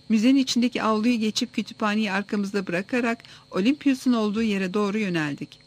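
plain reading voice

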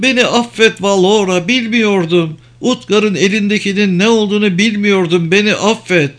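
high, distressed begging for forgiveness; acted anguished plea; pleading apology. A narrator's reading. Healer begs forgiveness in the story.

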